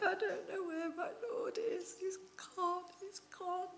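high, tearful whispered sob; weeping whisper. Acted. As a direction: whisper it through tears, heartbroken. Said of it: "Woman, why are you weeping?".

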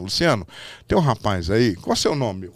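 imitated boss, low-pitched; Silvio on phone. Silvio rings Luciano about a young man he wants, low imitated phone talk. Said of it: "ligou pro Luciano Calegari".

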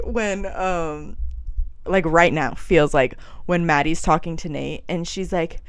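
ordinary delivery

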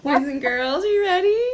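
put-on high, loud kids show host; overbright kids party host. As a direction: gush at the boys and girls. Mock kids show host greeting.

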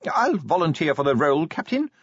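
small voice volunteering to a superior; little voice. A narrator's reading. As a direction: pipe up as a small official. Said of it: "said a little voice".

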